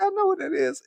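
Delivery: high-pitched I know that; high knowing quote. Retold or imitated. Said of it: "I was like".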